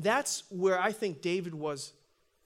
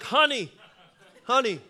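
high, loud call to his wife; comically loud "honey" down the line; 6 semitones higher, 11 dB louder than usual. Acted. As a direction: holler into a clunky old phone. Calling wife on brick phone.

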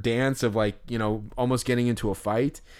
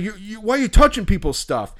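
angry quote; 8 semitones higher, 5 dB louder than usual. Retold or imitated. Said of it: "he's like".

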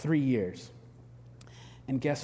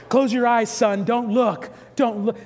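higher, louder urgent command to his son; grim dad; 7 semitones higher, 7 dB louder, slower than usual. Retold or imitated. Dad telling his son not to watch the dog put down, loud hammed-up urgency. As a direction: order the boy to look away, hammed-up gravity.

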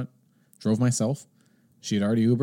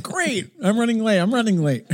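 high, elated exclamation; acted-out giddy excitement; giddy relief; 12 semitones higher than usual. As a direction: celebrate being late together, voice pitched up. Acting out delighted shared lateness.

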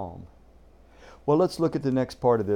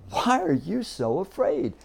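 a little irritated; 6 semitones higher, 4 dB louder than usual. Retold or imitated. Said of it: "That sounded a little irritated".

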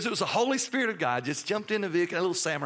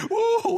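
overwhelmed cry; 6 dB louder than usual. Retold or imitated. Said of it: "here it came again".

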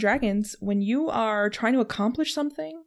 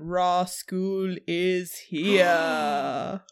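announcer voice; 3 semitones lower, much slower than usual. Acted. Podcast intro, announcer read.

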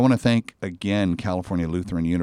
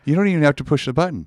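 quick, high and loud joke; excited; 9 semitones higher, 7 dB louder, much faster than usual. Real, not acted. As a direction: top the last line eagerly. Joking one-up about calling mom.

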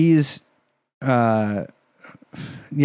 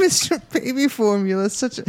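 put-on baby voice; 6 semitones higher than usual. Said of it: "I gotta work on my baby voice".

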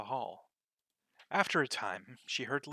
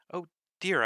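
exasperated oh dear; 6 semitones higher, 8 dB louder than usual. Acted. Alice gives up on sums.

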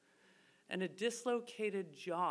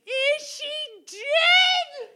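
slow, high, loud mock scream with a laugh in it; 14 dB louder, much slower than usual. The panicked driver screaming at the crash scene, hammed-up high shriek. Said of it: "she starts screaming".

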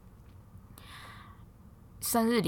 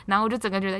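ordinary delivery

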